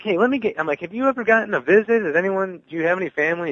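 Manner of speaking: high, eager questions to a new friend. Retold or imitated. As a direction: reach out with bright, lifted concern. Eagerly asking the inmate questions.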